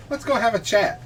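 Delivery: high, breezy invitation; nonchalant. Acted. Player pats Grail on the shoulder, nonchalant. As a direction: toss it off casually. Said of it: "You're all nonchalant".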